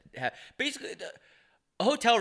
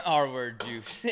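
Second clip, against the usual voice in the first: slow laughing repeat; 2 semitones higher, much slower than usual. Real, not acted. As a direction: laugh through the words.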